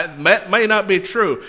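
usual speaking voice

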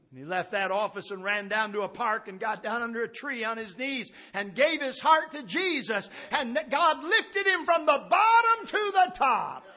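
rising pulpit climax, stretched at the end; building preaching climax. Real, not acted. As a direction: build from storytelling to a loud, high shout. Story built to preaching climax.

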